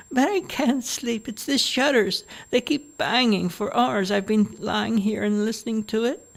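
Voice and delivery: put-on high, whiny old woman; a fussy mother grumbles about the noise, high; complaining old mother.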